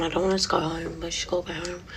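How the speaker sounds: usual speaking voice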